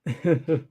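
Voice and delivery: short, quiet laugh; soft chuckle. Real, not acted. Streamer reading the chat, a soft chuckle. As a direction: chuckle briefly under the breath.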